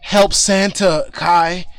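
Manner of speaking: pleading shout. Acted. Game master voicing Amelia begging Kai to help Santa, loud shout. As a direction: call for help across the battlefield. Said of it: "she's screaming out like".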